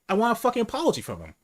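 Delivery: high, fast retold demand; indignant demand. Customer complaining to the manager about the cashier, indignant. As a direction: demand an apology, riled up.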